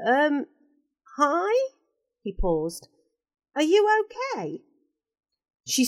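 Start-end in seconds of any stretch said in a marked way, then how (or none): 0.1-1.7 put-on a boy's voice, higher, hesitant; nervous lad asking, pitched up; unsure boy. Ricky nervously greets the crying woman, unsure boy. 3.6-4.6 put-on a boy's voice, higher, hesitant; nervous lad asking, pitched up; unsure boy. Ricky nervously greets the crying woman, unsure boy.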